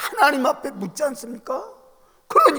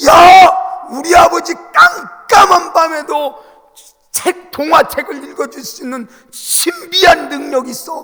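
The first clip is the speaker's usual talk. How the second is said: high, loud schoolboy boasting to classmates; boy bragging; 6 semitones higher, 10 dB louder than usual. Retold or imitated. Son boasts to classmates about his father, excited high bragging. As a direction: brag like a thrilled little boy. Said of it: "막 이렇게 하면서 자랑하는 거예요".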